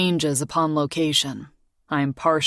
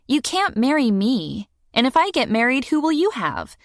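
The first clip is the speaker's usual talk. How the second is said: put-on much higher, earnest girl's voice; young girl; 9 semitones higher than usual. A narrator's reading. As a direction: worry aloud like a kid. Morgan voiced high.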